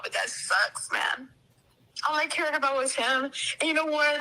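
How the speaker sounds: ordinary talk, nothing marked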